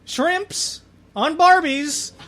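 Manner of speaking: high shouting Aussie caricature; Australian accent. Pretend. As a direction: yelp Aussie clichés up high.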